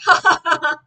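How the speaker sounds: loud jeering laugh; mocking laugh. Kids laugh at Greg, loud mocking laugh. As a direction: laugh at him like a crowd of kids. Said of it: "Everyone laughed".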